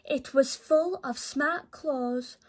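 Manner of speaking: ordinary delivery, nothing marked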